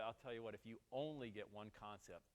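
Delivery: ordinary talk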